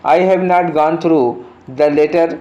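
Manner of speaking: reading the sentence very slowly, word by word; slow dictation read. Shown as a demonstration. Teacher reading the question sentence to students, very slow and deliberate. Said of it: "reads the exam sentence aloud".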